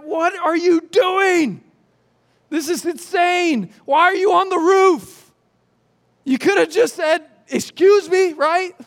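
homeowner yelling up at the men digging through his roof, outraged; exasperated homeowner. Retold or imitated.

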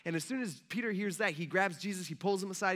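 usual speaking voice

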